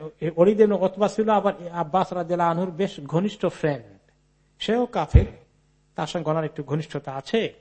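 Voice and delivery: ordinary delivery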